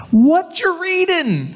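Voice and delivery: high, drawn-out mock yell; high-pitched holler to a man in a chariot; calling out. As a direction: call it across a distance, stretching the words. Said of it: "So he's yelling".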